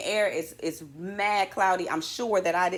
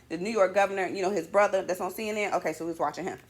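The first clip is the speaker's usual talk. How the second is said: normal talking voice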